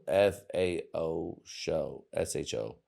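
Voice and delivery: letters F A O spelled one by one, slow; spelled out slowly. Shown as a demonstration. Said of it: "The song is called Faux Show".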